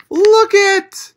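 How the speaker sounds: high, loud burst of delight; delighted exclaim. Real, not acted. Reading to children, pointing at the page with delight. Excited at happy picture in book.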